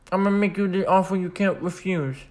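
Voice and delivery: bad Godfather impression, mouth turned down. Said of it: "that's a bad impression".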